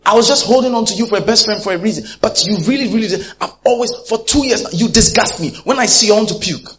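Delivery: high, loud, raging rant; acted furious shout. Preacher acting a best friend yelling in a fight, furious. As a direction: scream your disgust at the friend.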